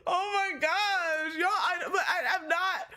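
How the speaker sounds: high, helpless real laughter in the voice; laughing protest. Real, not acted. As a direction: protest while cracking up. Cracking up at teasing.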